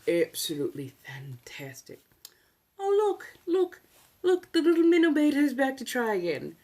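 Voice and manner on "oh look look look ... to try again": high, loud mocking coo; mock delight. Greeting a returning heckler with mock delight. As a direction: gleefully taunt in a raised, singsong pitch.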